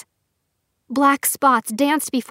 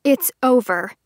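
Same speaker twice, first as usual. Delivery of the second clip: cold; 3 dB louder than usual. Acted. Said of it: "the coldness in her own voice".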